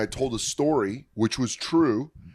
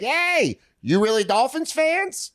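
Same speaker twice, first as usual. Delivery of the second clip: high, drawn-out mock holler; high-pitched heckling impression; imitated tailgater; 13 semitones higher, slower than usual. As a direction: call over to strangers as a hammered fan. Retelling a drunk tailgater calling out.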